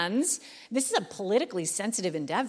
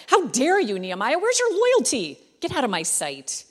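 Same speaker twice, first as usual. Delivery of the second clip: hammed-up royal fury at a servant; imagined angry king; 3 semitones higher, 5 dB louder than usual. Pastor imagining the offended king scolding Nehemiah, mock outrage. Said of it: "gotten angry and offended".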